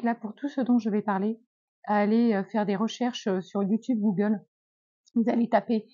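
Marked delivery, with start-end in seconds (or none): none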